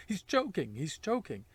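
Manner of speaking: high, loud cry of alarm; urgent scream. A narrator's reading. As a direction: yell it out as a frantic warning.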